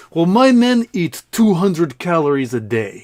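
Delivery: loud, high, slow acted indignation; pissed-off Russian general. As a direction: play an angry general boasting, drawn out and forceful. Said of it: "now is getting really pissed".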